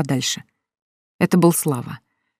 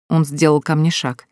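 ordinary delivery, nothing marked

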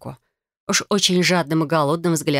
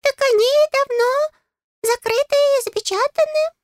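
very high, squeaky little voice; high-pitched fairy, questioning and fussing; chatty, nosy fairy; 3 dB louder than usual. A narrator's reading. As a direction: pipe up like a tiny busybody. Fairy Gustav informs her.

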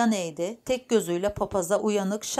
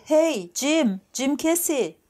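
high, raised call to someone at a distance; 7 semitones higher, 4 dB louder, much slower than usual. Acted. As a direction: shout out the name to summon him. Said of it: "diye bağırdı". (uzaktakine bağırarak seslenme)

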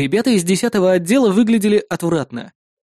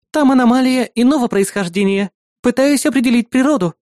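higher assistant voice, slightly at a loss; bewildered; 8 semitones higher, 3 dB louder than usual. A narrator's reading. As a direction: answer with mild confusion. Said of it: "растерянно ответила помощница".

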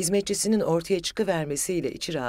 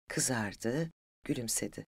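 usual speaking voice

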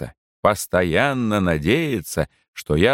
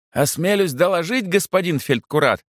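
high, thin call from another room; calling from the kitchen; 9 semitones higher than usual. A narrator's reading. Servant reports to the chaplain from the kitchen, calling out. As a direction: pitch it up to carry from afar. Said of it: "донеслось из кухни".

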